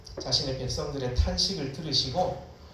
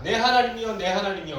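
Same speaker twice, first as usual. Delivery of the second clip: higher, urgent call to God; crying out; 4 semitones higher, 5 dB louder than usual. Shown as a demonstration. Psalmist calling twice on God, desperate cry. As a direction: call out desperately, pitched up from the first word. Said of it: "소리 지르면서 읽어야 돼".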